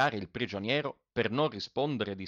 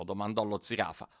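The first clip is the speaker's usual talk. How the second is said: ordinary delivery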